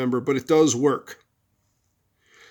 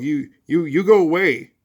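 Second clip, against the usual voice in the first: put-on louder, higher kid yelling a dog away; mock yell of an antagonised boy; acted boy's yell; 3 semitones higher, 5 dB louder than usual. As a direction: shoo off a threatening dog. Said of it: "he yells at the dog".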